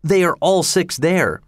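high, falling on THEY; stating it eagerly, sure of it; All six there said as a plain excited fact; excited statement. Acted. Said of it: "I cried".

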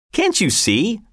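high, jeering call; mock shout. A narrator's reading. Friend joining the heckler against soldier, mock shouting. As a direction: call it out high without real volume. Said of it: "shouted a friend".

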